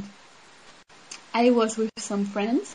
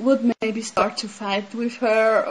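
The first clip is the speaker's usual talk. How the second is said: normal talking voice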